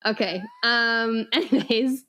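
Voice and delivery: ordinary talk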